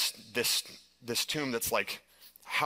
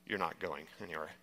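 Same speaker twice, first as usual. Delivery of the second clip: low, much softer quoted verdict; imitating grave guide; 6 semitones lower, 9 dB quieter than usual. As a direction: drop pitch and volume to deliver bad news. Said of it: "has a different look on his face".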